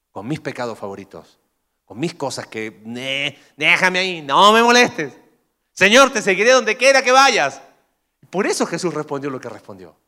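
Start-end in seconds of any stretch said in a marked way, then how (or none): 2.9-7.6 loud, high whiny brush-off; lazy follower. Pretend. Preacher mocks a comfortable follower, whiny and loud. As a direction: wave God away, then promise loudly. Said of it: "Quiero ser un seguidor de Jesús".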